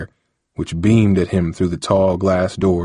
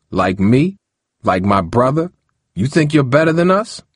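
defensive anger; 6 semitones higher than usual. Acted. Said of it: "asked defensively, with a slight flash of anger".

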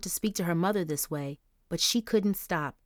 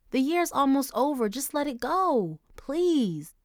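slow, higher pleading; begging; 7 semitones higher than usual. Acted. Daughter begging her mother not to call the school. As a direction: beg her to let it go. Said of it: "Angel begged".

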